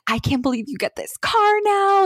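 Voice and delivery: high, gushing disbelief; excited for her. Retold or imitated. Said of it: "She was so excited for me".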